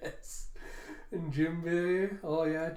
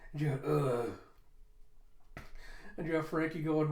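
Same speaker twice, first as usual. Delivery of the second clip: usual speaking voice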